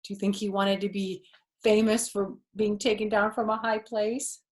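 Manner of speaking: plain reading voice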